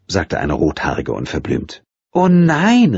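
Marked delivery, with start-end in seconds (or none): 2.1-3.0 high, louder cry of dismay; exclaiming. A narrator's reading. Nina hearing the editor is drunk, crying out. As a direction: call it out, higher and louder. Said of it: "rief Nina".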